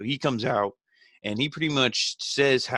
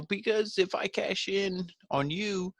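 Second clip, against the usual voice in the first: mock Miz voice; 11 semitones higher, 4 dB quieter, slower than usual. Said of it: "he's like".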